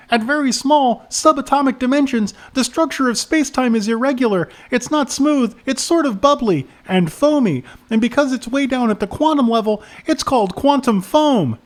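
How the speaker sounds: high-pitched little know-it-all reciting; earnest kid. Acted. Kid explaining physics to the adults, high earnest voice. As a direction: lecture earnestly in a high child voice. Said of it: "The kid looked at them earnestly".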